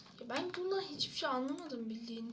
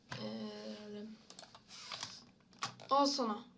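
normal talking voice

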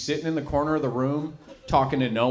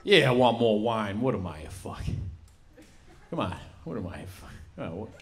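tripping New York mafioso. Acted. Mobster on mushrooms at dinner, lightly voiced, fading. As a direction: drift off as the mushrooms hit. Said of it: "my impression of a guy in the mafia".

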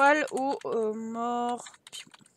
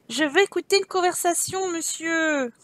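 character request; 6 semitones higher, 6 dB louder than usual. Pretend. Voicing character asking.

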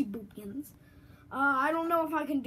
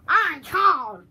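much louder, higher squeaky mushroom voice; Toad impression; 4 semitones higher, 11 dB louder than usual. Kid doing his Toad impression, loud and pitched up. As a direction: shout it like a tiny excited mushroom. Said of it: "I can do Toad".

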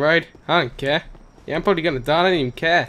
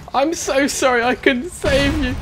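mock sorrow; 7 semitones higher than usual. Mock grief over dead character.

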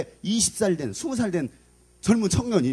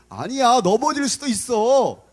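ordinary delivery

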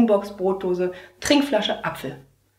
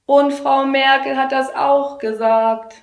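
put-on slow, high, louder child chorus; know-it-all kids; 8 semitones higher, 7 dB louder, slower than usual. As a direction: insist like a stubborn class. (trotziger Kinderchor)

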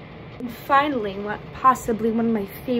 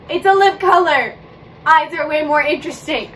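higher, louder mock outrage; hammed-up indignation; mock rant; 5 semitones higher, 7 dB louder than usual. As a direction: rant playfully about lip color. Mock rant about lipstick.

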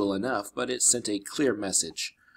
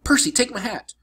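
much higher, urgent instructions; urgent girl's voice; 7 semitones higher than usual. Acted. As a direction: push him to act now.

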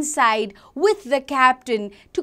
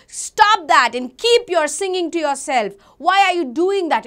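loud, irritated scolding; angry captain; 3 semitones higher, 5 dB louder than usual. Acted. Captain scolding the humming boy, acted loud anger. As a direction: snap at him to stop, acted. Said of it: "the captain was very angry".

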